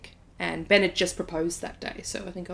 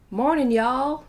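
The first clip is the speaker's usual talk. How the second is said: put-on American drawl, higher pitched; cheery imitation American greeting; American accent; 6 semitones higher, 3 dB louder, slower than usual. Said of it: "That's my American accent".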